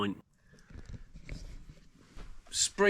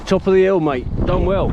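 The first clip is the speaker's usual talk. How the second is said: cheering; 6 semitones higher, 7 dB louder than usual. Real, not acted. Cheering friend at the top.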